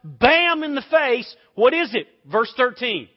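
loud, high, driving preacher's voice; big revival-style delivery; preaching at full force. Acted. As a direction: preach it hard to the congregation.

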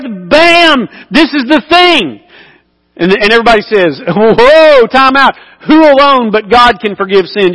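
high, excited preacher's voice; preaching at full force. Acted. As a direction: drive the punchline home to the crowd. Preaching the crowd's reaction.

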